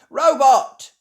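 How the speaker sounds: high, loud command; shouting. A narrator's reading. Ben ordering the robot, high shout. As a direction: shout to stop the machine. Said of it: "shouts Ben".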